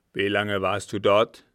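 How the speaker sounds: put-on loud, gruff old captain; forceful questioning; urgent interrogation. A narrator's reading. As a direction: demand it at full voice. Agitated captain interrogates servant.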